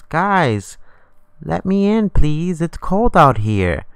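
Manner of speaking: put-on high child's voice, last words drawn out; pleading little voice. Little hand through the mail hole begging, high pleading voice. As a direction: imitate a small kid pleading to come in.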